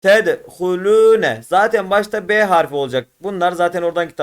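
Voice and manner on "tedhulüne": Tedhulüne slowly, with a long held lü; loud, drawn-out Tedhulüne; elongated reading. Shown as a demonstration. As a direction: stretch the lü in Tedhulüne. Teacher models elongated Arabic reading.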